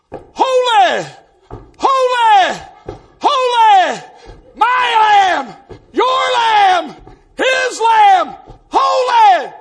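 high, loud bursts of holy fervour; imitated worshipper's shout. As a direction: holler each phrase like an old revival brother. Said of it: "And old Brother Paul, he'd jump up".

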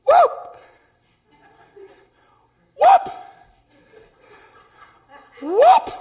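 sudden high-pitched yelp; imitated worshipper's whoop. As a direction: let out short, high revival whoops. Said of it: "and she'd go".

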